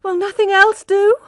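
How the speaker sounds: high, loud cry of despair; high, loud despairing plea. A narrator's reading. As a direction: plead in desperation. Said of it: "cried Tess in despair".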